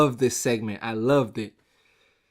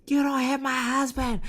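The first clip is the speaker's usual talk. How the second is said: much higher shrieking mock outrage; Stephanie imitation; 11 semitones higher than usual. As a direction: squeal it like an outraged wife. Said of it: "Stephanie's like".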